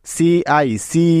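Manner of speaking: CIC drawn out letter by letter; teacher spelling CIC slowly. Shown as a demonstration. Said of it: "বানানটা কেমন হলো".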